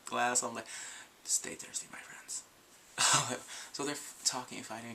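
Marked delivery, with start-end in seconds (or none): 1.3-2.4 whispered ad tagline, softer; mock commercial spokesman hush; ad-man voice. Vlogger quoting the Dos Equis ad in a hushed voice. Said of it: "adding for Dos Equis".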